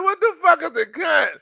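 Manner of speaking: high, loud, cracking with laughter; mock outrage at a word you never heard; shocked disbelief. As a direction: blurt it out stunned and laughing. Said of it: "I'm like".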